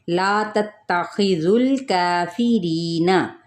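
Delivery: ला तत्तखिजुल काफिरीना recited slowly with madd held; measured Quranic recitation. Shown as a demonstration. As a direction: chant it slowly, vowels stretched. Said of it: "ला पे अलिफ मद्दा".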